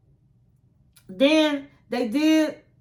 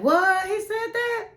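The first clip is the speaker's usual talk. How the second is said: high, incredulous mock gasp of a question; acted-out astonishment; shocked disbelief; 10 semitones higher than usual. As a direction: imitate a stunned crowd, pitch climbing high. Retells everybody's shocked reaction.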